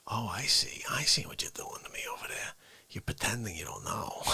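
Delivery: low mock-accusing whisper. Real, not acted. Streamer playfully accusing the software, whispered. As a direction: murmur it with playful suspicion. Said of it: "whispering accusation to chat".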